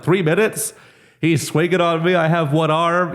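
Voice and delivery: high, incredulous rush of panic; retold disbelief. Fighter reacting to coach's time call, mock incredulous. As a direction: react like time just stopped, half-joking.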